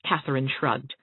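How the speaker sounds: usual speaking voice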